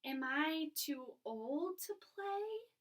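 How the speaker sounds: slow, worried question; upset. Shown as a demonstration. Mister Putter asking if he's too old, upset. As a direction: ask it as if afraid of the answer. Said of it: "He might say it like this".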